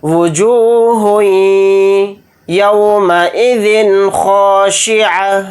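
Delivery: وجوہ merged into یومئذ, held on ya; slow chanted idgham; idgham demo. Shown as a demonstration. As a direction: blend وجوہ into یومئذ with a pause on ya. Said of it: "ادغام اس طرح سے کریں گے".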